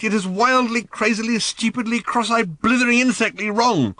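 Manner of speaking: loud, high exasperated ranting; despairing rant. A narrator's reading. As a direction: pour out total exasperation.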